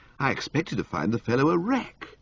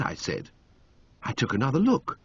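usual speaking voice

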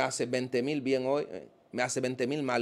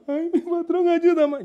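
much higher, louder whiny plea for help; mock desperate cry; 8 dB louder, slower than usual. Imitating a rich man crying to his boss for help, high mock wail. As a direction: wail for your boss to save you, hammed-up. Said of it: "llegó un tipo allí gritando".

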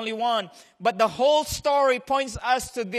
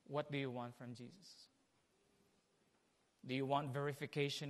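much softer, searching questions; hushed questioning; 8 semitones lower, 11 dB quieter than usual. Acted. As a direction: probe the listeners gently.

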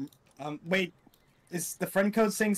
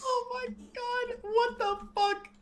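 shocked squeal; slower than usual. Real, not acted. Shocked by the character.

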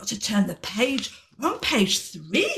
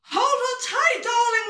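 high, urgent storybook call; acted shout; 12 semitones higher than usual. Fabby warning Marvin up the tree, high acted shout. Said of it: "Marvin shouted Fabi".

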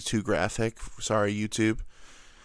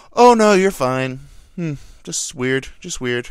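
doctor voice; 3 semitones higher, 8 dB louder than usual. Retold or imitated.

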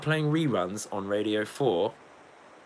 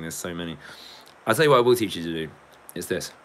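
ordinary talk

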